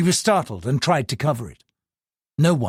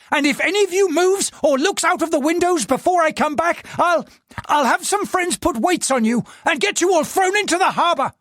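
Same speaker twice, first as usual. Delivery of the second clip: higher furious threats at the servants; raging threat; 13 semitones higher than usual. Acted. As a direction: threaten them, barely in control. Husband threatening servants in rage.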